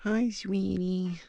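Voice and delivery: high, slow cooing. Real, not acted. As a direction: coo gently to a scared kitten. Cooing to the cat.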